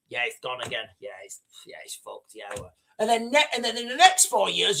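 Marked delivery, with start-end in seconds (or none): 0.1-2.7 much softer, lower, resigned grumble; deflated mutter. Retold or imitated. Driver leaving the garage, quietly deflated and resigned. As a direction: mumble it like the car just died again. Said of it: "you're like".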